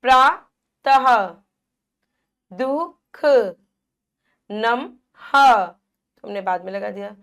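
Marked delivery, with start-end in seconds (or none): 0.0-1.3 प्रातः slow and louder, ending on a breathy ह; stretched visarga. Shown as a demonstration. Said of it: "हा की ध्वनि प्रकट करेगी". 4.5-5.6 नमः very slow and louder, ending on a ह sound; stretched visarga. Shown as a demonstration. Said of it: "हा की ध्वनि प्रकट करेगी".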